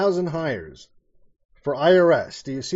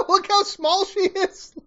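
laughing. Real, not acted. Laughing at a clip.

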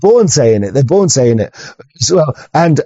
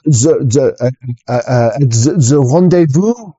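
stumbling franglais in a mock French accent; French coast guard accent. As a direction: grope for words in a French accent. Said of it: "Coast Guard would be like".

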